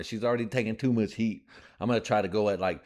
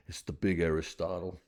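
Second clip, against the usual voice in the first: ordinary delivery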